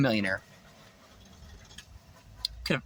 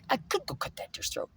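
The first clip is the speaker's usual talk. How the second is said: whispered; 5 semitones higher, 10 dB quieter than usual. Acted. Musing aloud about the deer.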